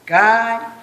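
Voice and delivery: a strong, loud sung God. Shown as a demonstration. Director pushing the group for a bigger God. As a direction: belt out the word God. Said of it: "just do God".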